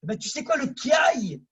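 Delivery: high, loud burst on le kiaï; preacher erupting into a martial cry; excited shout. Real, not acted. Dramatic martial arts cry.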